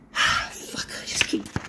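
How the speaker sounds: real whispered grumble after a sigh; frustrated whisper. Real, not acted. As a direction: mutter it under your breath, annoyed. Frustrated sigh, fumbling with object.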